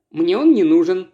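ordinary delivery, nothing marked

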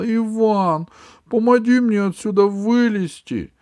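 high, loud pouty princess. Acted. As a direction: demand help like a spoiled princess. Princess voiced, pouty request.

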